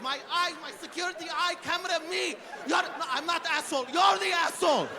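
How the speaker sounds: very high-pitched mock rant of a shawarma boss; snapped, acted tirade. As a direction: lose it at your worker in a strained high voice. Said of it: "And he snapped".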